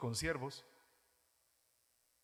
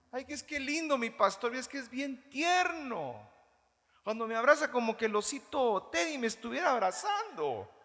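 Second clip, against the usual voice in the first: higher, a hint of a doting churchgoer; mock adoring churchgoer; 6 semitones higher, 3 dB louder than usual. Pastor mimicking a churchgoer who adores hugs, lightly cooing. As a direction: coo lightly over the sweet pastor.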